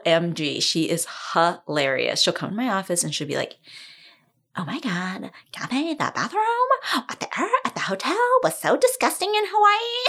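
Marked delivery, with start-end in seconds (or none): 4.6-10.1 put-on high, laughing bratty girl voice; spoiled teen girl. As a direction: whine like a pampered teen.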